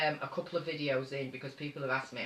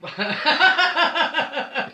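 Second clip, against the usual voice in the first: loud burst of laughter; 13 semitones higher, 12 dB louder than usual. Real, not acted. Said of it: "Belinda said she's having a rest".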